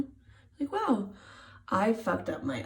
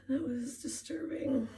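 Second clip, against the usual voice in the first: whispering; 5 semitones higher, 6 dB quieter than usual. Real, not acted. Whispered aside about gray hair.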